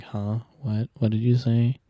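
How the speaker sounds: mock strangers straining to hear, calling out; imitating confused listeners. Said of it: "people are just like".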